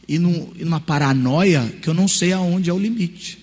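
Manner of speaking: ordinary talk, nothing marked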